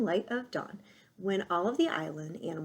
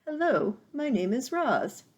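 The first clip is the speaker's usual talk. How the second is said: higher, louder owl voice; 7 semitones higher, 6 dB louder than usual. Acted. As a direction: owl recalling the words. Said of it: "Swooper said".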